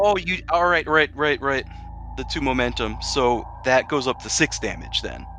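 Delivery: ordinary talk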